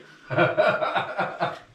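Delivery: loud laugh bursting out. Real, not acted. Table laughs at the joke.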